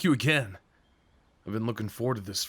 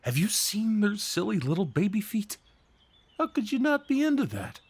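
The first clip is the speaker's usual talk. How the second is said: big guy gushing over duckling feet, pitched way up; excited about ducks; 9 semitones higher than usual. Real, not acted. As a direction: squeal it, giddy and much higher. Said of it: "doesn't mean I don't get to be excited about ducks".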